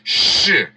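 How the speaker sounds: "sh" said alone, sustained; sh sound. Shown as a demonstration. Preacher demonstrating the sound, a stressed "sh". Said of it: "se pronuncia sh".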